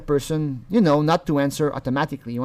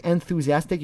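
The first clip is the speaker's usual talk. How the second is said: normal talking voice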